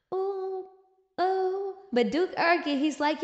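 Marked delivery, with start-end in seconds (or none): none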